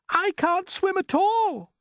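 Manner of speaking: very high, loud, slow panic; cried. A narrator's reading. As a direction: cry it in alarm. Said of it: "cried the ladybird".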